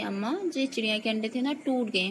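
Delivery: ordinary delivery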